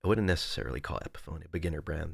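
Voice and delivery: breathy whisper, quick and hushed; whispered aside. Real, not acted. Streamer whispering a chat comment to herself. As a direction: murmur it under your breath.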